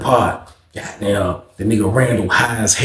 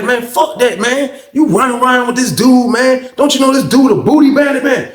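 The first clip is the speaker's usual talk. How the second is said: high, insistent imitation of an arguing dude; mock angry protest; 10 semitones higher than usual. Dude warning Randall about Luke, mock angry protest. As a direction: act out a heated objection, pitched up.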